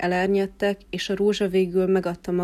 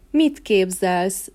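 slow, haughty and a bit louder; haughty ringing retort; 4 dB louder, much slower than usual. Acted. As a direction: draw it out like an offended queen. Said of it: "zengte Rosalinda".

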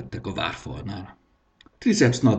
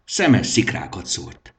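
ordinary delivery, nothing marked

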